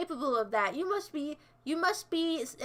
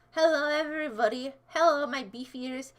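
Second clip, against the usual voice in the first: ordinary talk, nothing marked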